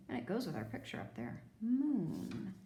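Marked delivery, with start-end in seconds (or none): none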